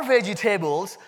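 Indian-accented "vegetables", VEJ-ih-TAY-buls; four-syllable Indian English "vegetables". Shown as a demonstration. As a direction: play up the Indian accent, "table" sounded in full in "vegetables". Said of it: "That's my Indian accent coming out".

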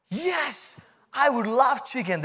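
high-pitched, thrilled acceptance; retold delight, leaping at the offer; eager yes. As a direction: jump at it in a high voice. Retelling his eager answer to chicken.

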